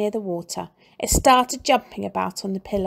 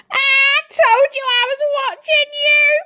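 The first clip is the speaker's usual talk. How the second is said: cackling; 7 dB louder than usual. A narrator's reading. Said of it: "cackled Missus Twit".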